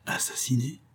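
hushed, stunned question; dull, disbelieving murmur; muffled murmur. A narrator's reading. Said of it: "murmura Ferval sourdement".

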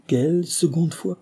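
high, slow, uneasy question; understated fearful query; shuddering question. A narrator's reading. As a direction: ask slowly with a shiver of dread, pitch rising. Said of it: "interrogea Bertie en frissonnant".

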